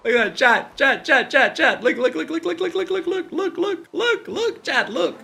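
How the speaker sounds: high, giddy squeals of look look look; excited calling. Real, not acted. Streamer squealing at chat to look at the funny thing.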